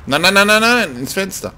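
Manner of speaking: high, loud rapid noes; a high, loud burst of frantic protest. Real, not acted. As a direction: panic at the screen. Game goes wrong.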